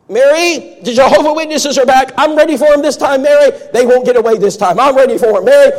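put-on high, loud, agitated old man voice; excited old man. Charles calling to his wife Mary, high and agitated. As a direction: sound like a cranky man bracing for visitors. Charles spots door knockers.

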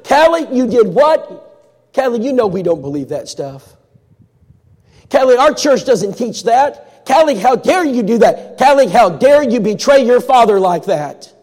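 high, loud and slow, scolding; how dare you, building outrage; angry mother. Retold or imitated. Mother scolding Callie for betraying her father, loud and angry.

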